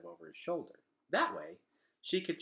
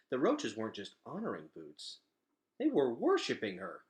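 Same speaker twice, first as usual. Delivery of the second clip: usual speaking voice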